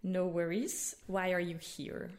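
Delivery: usual speaking voice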